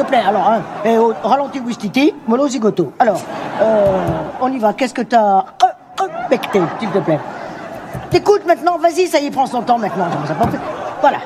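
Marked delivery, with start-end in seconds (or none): none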